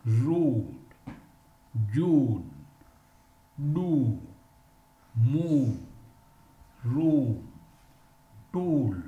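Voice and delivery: "road, June, do, move, room, tool, crew, flew, blue, true" each stretched and stressed; long oo drill. Shown as a demonstration. Teacher modelling the long oo for students, emphatic drill. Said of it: "examples are".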